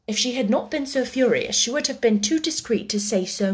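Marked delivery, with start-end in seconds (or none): none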